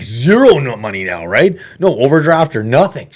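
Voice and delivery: loud, high-pitched exclamation; emphatic disbelief. Retold or imitated. Storyteller reliving being left with no money, loudly incredulous. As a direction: hammer home the retold shock, voice jumping up. Retelling shock at having no money.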